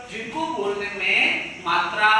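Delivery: ordinary delivery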